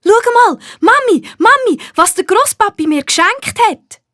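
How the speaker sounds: put-on high, loud child's voice calling out to Mami; a little girl showing off her gift, thrilled; excited little girl calling. A narrator's reading. Nina calls her mum to show grandpa's gift, excited high child voice. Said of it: "ruft Nina".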